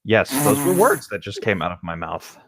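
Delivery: ordinary delivery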